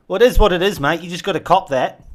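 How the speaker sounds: high, loud shouted reply; loud yelling. Retold or imitated. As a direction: yell it back down. Yelled reply with mate.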